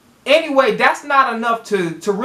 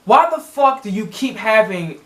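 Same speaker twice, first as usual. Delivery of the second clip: usual speaking voice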